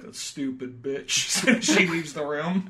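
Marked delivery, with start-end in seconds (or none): 0.0-1.6 slow, muttered Southern drawl; slow, low mock-Gump insult; sneering Forrest. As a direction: spit it out slowly under your breath. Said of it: "He's like".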